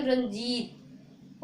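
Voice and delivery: Ranjit drawn out slowly, pressing on the long ई in जीत; teacher showing the effort in the vowel; stressed long ee. Shown as a demonstration. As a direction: lean on the ई of Ranjit, slow. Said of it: "इसमें थोड़ी ताकत लगती है".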